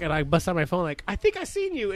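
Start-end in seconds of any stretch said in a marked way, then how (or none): 1.1-1.9 very high-pitched, playful voice; teasing singsong. Retold or imitated. Tenant confronting landlord with a photo, high teasing voice. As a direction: lift it way up, teasingly.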